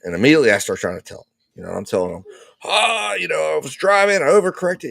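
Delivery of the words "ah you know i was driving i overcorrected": high-pitched, agitated reenactment of explaining; excited retelling. Retold or imitated. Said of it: "I'm excited".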